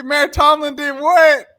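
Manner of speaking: ordinary talk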